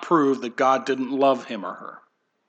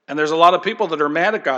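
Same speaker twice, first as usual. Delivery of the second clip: normal talking voice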